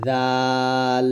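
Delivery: জাল drawn out long and slow, tongue tip to upper teeth; Arabic letter demo. Shown as a demonstration. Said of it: "তাহলে এটা হবে". (জাল ধীরে টেনে উচ্চারণ)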